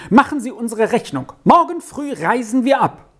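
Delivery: high-pitched agitated order; urgent demand. A narrator's reading. Agitated guest ordering the bill from innkeeper, urgent high demand.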